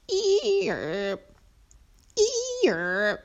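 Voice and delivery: high squealed bray dropping off; drawn-out, high-pitched hee-haw noise; donkey bray. A narrator's reading. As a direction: imitate a donkey braying. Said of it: "Winslow's reaction was harder to distinguish".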